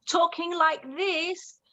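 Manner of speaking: high, slow, drawn-out English accent. Shown as a demonstration. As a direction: stretch it out in a put-on English accent. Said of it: "like this 'cause I'm half English".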